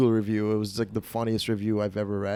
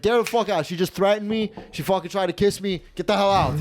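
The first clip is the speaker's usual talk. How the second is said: high-pitched indignant rant; hammed-up protest to the boss; mock outraged yelling; 8 semitones higher than usual. Doorman tells owner she threatened him, mock outraged yelling. Retold angry complaint to owner.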